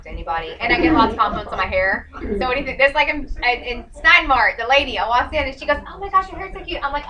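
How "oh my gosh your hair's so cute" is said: higher-pitched imitated admirer; mock gush over someone's look; gushing compliment. Voices a woman giving a compliment.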